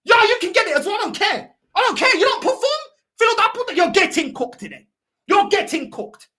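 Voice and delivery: high, loud, heated rebuke; angry telling-off. Real, not acted. Angry telling-off of another player.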